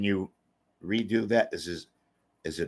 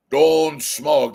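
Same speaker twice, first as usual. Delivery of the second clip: Yul Brynner; 6 semitones higher, 7 dB louder than usual. Retold or imitated. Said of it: "I'm Yul Brynner".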